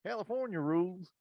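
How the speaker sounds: tentative Southern Alabama impression; attempted Southern accent. Warming up as the coach, tentative Southern accent. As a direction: search for the Southern accent, softly. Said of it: "I can't think Alabama, I can't think Southern".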